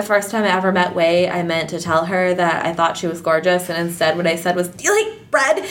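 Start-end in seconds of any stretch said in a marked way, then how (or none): 4.7-5.6 high, flustered blurt; awkward blurt. Retold or imitated. Tongue-tied admirer meeting a gorgeous woman, awkward high blurt. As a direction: blurt it out high, imitating your awkward self.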